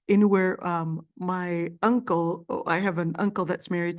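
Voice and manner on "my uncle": ordinary talk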